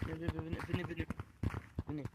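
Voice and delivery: hushed urgent call to follow; whispering while hiding. Real, not acted. Hiding from the ghost.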